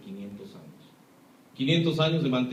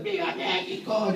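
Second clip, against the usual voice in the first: mock patriotic yell; 6 semitones higher, slower than usual. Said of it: "gritando como locos".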